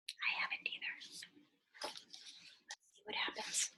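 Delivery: hushed, suspenseful whisper. Acted. Reader whispers to children before the page turn, hushed whisper.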